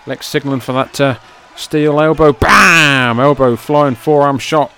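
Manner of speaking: building to loud excited calls; wrestling commentator. Acted. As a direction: hype the finish like a ringside announcer. Live wrestling play-by-play.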